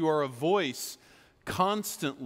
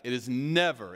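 ordinary talk

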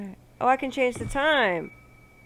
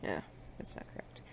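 quick whispered aside; whisper to self; 20 dB quieter, much faster than usual. Real, not acted. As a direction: breathe it to yourself.

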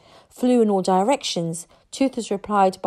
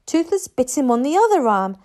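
ordinary delivery

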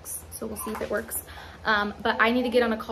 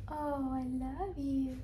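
tender; 2 semitones higher, 9 dB quieter, slower than usual. Real, not acted. Tender to baby.

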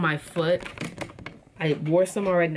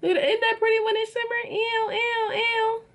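very high, fast, squeaky put-on voice; squeaky gush. Showing her new anklet to viewers, squeaky gushing voice. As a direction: squeal over the shiny anklet like a cartoon.

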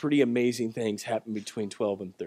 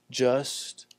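whispered just; 3 semitones lower, 4 dB quieter than usual. Real, not acted. Pause before punchline question.

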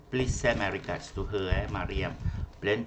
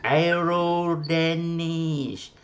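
name stretched very slowly, raised pitch; drawn-out greeting; 5 semitones higher, much slower than usual. Real, not acted. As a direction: hail the pupil, stretching each syllable. Greets pupil who finally answered.

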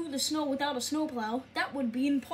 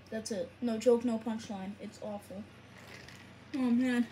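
ordinary talk

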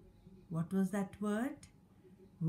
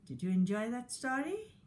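ordinary delivery

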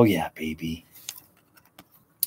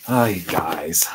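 whisper; 4 semitones lower, slower than usual. Real, not acted. Leaning into the camera for the viewers, whispered. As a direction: breathe it out slowly as a whisper. Hushed intro to the hammock.

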